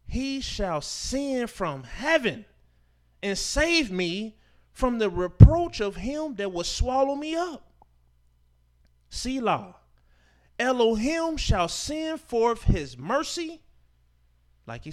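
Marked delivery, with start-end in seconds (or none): 0.1-9.8 very slow, higher scripture reading; preacher slows down for the third verse; slow emphatic reading. Shown as a demonstration. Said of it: "listen in the third verse". 10.6-13.6 slow, loud, higher scripture line; preacher lifts the verse to the congregation; emphatic proclamation. Shown as a demonstration. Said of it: "listen in the third verse".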